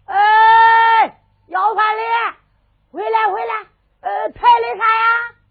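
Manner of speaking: high, loud shout across the street; calling out. Acted. Servant shouts after the beggars in the street, calling loudly. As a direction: hail the beggars from afar.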